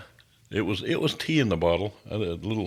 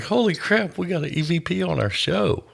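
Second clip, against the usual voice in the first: excited; 9 semitones higher than usual. Retold or imitated. Retold excited reaction.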